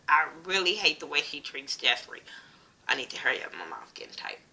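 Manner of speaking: hushed confession under the breath; whispered aside to viewers. Real, not acted. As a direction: drop to a whisper as if sharing a secret. Aside while recapping.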